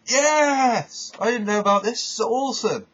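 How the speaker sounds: high, loud, thrilled yelp of surprise; giddy fan shouting yes; excited cheer. Retold or imitated. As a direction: cheer like a fan seeing it for the first time. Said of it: "I went".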